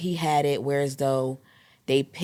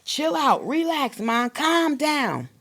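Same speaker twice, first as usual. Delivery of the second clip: put-on much higher, slowed boy's voice; much higher, slow child voice telling mom to relax; imitating her son; 11 semitones higher, slower than usual. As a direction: play the little son calming his mom. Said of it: "And now he also says".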